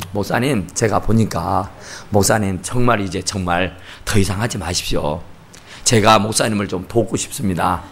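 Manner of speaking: low, grave and polite, soft delivery; solemn advice. Retold or imitated. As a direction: imitate an earnest man counselling the pastor. 남편이 정중히 전업을 권유. (낮고 점잖게 권유하는 말투)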